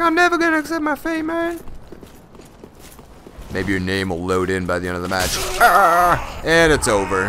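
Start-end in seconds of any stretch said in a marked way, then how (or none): 0.0-1.6 high squeaky put-on voice; mock sore loser. Gamer voicing the defeated opponent refusing to give up, squeaky. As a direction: whine it like a stubborn child. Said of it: "He's like".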